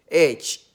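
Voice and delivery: "H" said slowly without its breathy consonant; dropped H. Shown as a demonstration. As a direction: draw out "H" as a vowel-led sound. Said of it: "don't know how to pronounce the word H".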